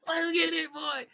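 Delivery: high, laughing hype; giddy laugh into a hype call; hyped. Real, not acted. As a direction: burst out laughing and get pumped. Hyped laugh after the song.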